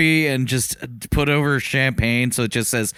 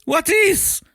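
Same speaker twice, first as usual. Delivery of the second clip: shocked. Acted. Mock alarm, higher.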